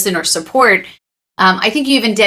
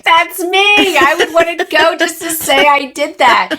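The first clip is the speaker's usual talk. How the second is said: high-pitched, amused quoted exclamation; excited self-recognition; 8 semitones higher than usual. Retold or imitated. Interviewer quoting her reaction to the Kilimanjaro story, excited self-recognition. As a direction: exclaim it as if spotting yourself, voice lifted.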